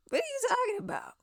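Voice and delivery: high, thin, quick squeak; incredulous. Real, not acted. Reacting to the singer's claims, squeaky incredulity. Exasperated disbelief at the crying.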